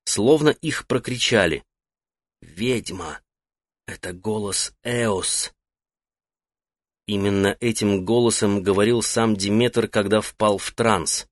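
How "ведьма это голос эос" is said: breathless whisper, slowed right down. Acted. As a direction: hiss it barely aloud in alarm. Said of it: "старик неслышно произнёс слова".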